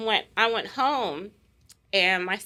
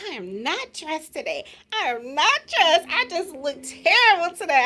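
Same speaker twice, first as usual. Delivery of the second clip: mother protesting; 7 semitones higher, 4 dB louder than usual. Retold or imitated. Mother refusing to come inside.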